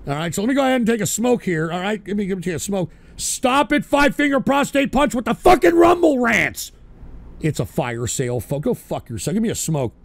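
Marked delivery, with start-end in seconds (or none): 3.2-6.7 angry scolding. Real, not acted. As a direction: snap at the troll. Scolding troll again.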